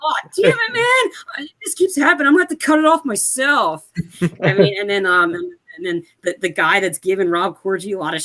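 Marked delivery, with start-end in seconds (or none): 0.0-3.9 very high, strained whiny voice; mock panicked bellhop. Bellhop with arm stuck, high panicked mock whine. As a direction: squeal it out like a trapped bellhop.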